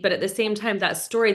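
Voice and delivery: usual speaking voice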